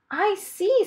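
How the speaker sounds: smiling princess voice. Acted. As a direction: answer brightly as the princess.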